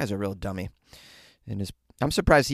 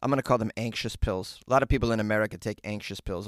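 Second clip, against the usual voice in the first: usual speaking voice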